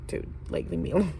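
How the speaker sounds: hushed, annoyed inner thought; muttered aside. Retold or imitated. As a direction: mutter it under your breath, fed up. Real thought behind polite reply.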